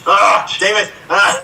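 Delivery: exasperated, pitch jumping up; frustrated outburst. Real, not acted. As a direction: curse a wasted guess. Contestant thwarted by a clue.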